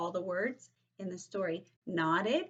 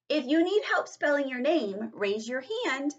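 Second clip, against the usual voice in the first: higher, projected teacher voice; raised, clear classroom call; teacher's instruction; 7 semitones higher, 4 dB louder than usual. Acted. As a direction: announce it to the whole class.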